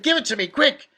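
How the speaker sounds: loud, high excited order; urgent demand. A narrator's reading. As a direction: demand it at once, voice jumping up. Father urgently demands the found ticket.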